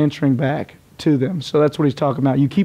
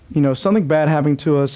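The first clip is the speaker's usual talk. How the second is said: normal talking voice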